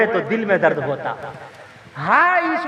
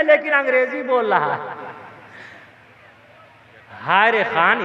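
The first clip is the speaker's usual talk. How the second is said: ordinary talk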